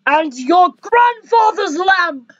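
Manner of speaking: ordinary talk, nothing marked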